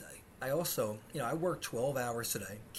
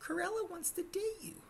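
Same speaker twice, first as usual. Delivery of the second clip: put-on much higher, girly seventh-grade messenger; schoolgirl voice; 3 dB quieter than usual. Schoolgirl telling Fran a girl likes him, high girly voice. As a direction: chirp it like a young schoolgirl. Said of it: "Her friend came up to me and said".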